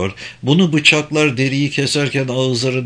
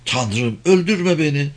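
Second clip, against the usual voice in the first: mild screamed plea; 6 semitones higher than usual. A narrator's reading. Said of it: "çığlık atan bir ses".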